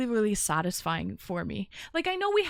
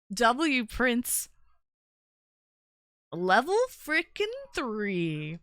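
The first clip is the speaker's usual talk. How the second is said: hoarse sleep deprived hype; 6 semitones higher, 6 dB louder, much slower than usual. Real, not acted. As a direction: hype shout, loud and drawn out. Hyping up a gift subscription.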